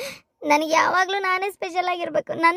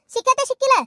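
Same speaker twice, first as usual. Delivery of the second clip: angry mockery; 6 semitones higher, 4 dB louder, faster than usual. Angry mother-in-law mocks her.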